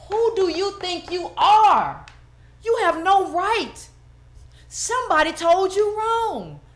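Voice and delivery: indignant confrontation. Real, not acted. Speaker confronting a critic over being light skinned, indignant and slow.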